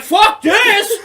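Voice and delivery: high, loud laughing yell; laughing shout. Retold or imitated. Telling how he took on two players at once, shouting. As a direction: bellow it, half laughing. Retold fight-picking shout.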